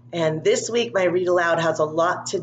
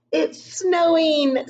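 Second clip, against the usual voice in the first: put-on higher, drawn-out happy child voice; excited little boy; 11 semitones higher, much slower than usual. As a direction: sound like a kid thrilled by the first flake.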